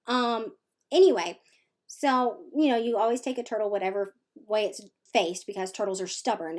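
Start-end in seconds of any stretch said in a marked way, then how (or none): none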